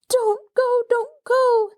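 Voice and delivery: put-on very high, loud wailing child; piteous howl. A narrator's reading. Abandoned child wailing to her cousin, piteous. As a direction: wail it up from the pit. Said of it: "in a piteous howl".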